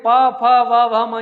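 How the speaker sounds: पा, फा, वा called out loud to the class; loud teaching demo. Shown as a demonstration. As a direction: boom out each lip syllable for the class. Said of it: "तो यहाँ पर देखें".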